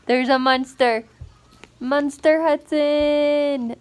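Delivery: loud, high greeting to her son; mock monster game, loud and drawn out; playful. Real, not acted. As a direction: play along with the little monster. Playing monster with toddler.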